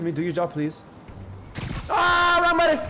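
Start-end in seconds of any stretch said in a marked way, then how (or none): 1.9-2.8 shrill high yelp at a mistake; real panicked yell. Real, not acted. Gamer yelling after hitting the wrong button, panicked.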